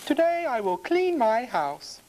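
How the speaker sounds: high-pitched storybook housewife; farmer's wife. A narrator's reading. Farmer's wife planning her cleaning, high and purposeful. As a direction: announce it in a high woman's character voice. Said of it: "the farmer's wife says".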